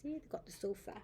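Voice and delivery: ordinary delivery, nothing marked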